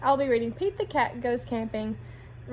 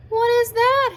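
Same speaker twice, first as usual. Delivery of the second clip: high, loud alarmed question; startled question; 11 semitones higher, 6 dB louder than usual. A narrator's reading. Pete hears a hooting sound in the dark, high and alarmed. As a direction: sound spooked by the noise.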